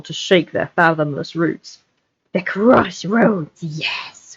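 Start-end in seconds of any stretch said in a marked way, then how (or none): 2.3-4.3 slow, hushed creature voice; whispered Gollum. Acted. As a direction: murmur it like Gollum. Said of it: "whispered Gollum".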